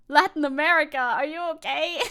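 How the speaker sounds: loud mock concern; loud disbelief. Real, not acted. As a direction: call out to the continent in disbelief.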